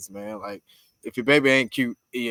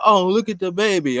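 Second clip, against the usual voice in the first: higher, louder mock gushing; mock baby admiration; 7 semitones higher, 6 dB louder than usual. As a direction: put on a loud coo over someone's baby. Mocking people gushing over babies.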